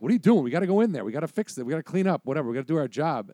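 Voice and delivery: high, loud, impatient orders; acted exasperation, pushing him back to work; berating dad. Jerk dad ordering his son to work, berating.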